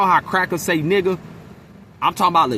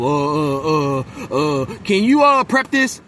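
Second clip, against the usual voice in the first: coworker fumbling for requests; 3 dB louder, slower than usual. Retold or imitated. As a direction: mimic her grasping for something to ask. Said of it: "dig in your pocket for some shit to bother me".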